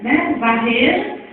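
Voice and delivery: ordinary talk, nothing marked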